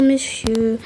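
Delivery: ordinary talk, nothing marked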